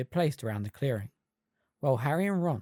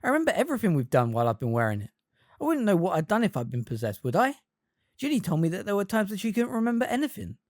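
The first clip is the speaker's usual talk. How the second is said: defensive; 6 semitones higher, 3 dB louder, faster than usual. A narrator's reading. Harry defending himself quickly.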